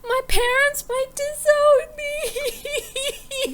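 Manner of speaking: high, wailing mock plea; distraught pleading. Fanny begs the party to save her job, mock-distraught wail.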